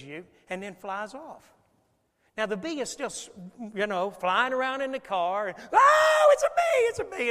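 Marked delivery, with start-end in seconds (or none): 5.7-7.3 high-pitched panicked shout; loud, acted-out scream of alarm; screaming kids. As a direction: shriek it like frightened kids in a car. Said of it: "And the kids are screaming".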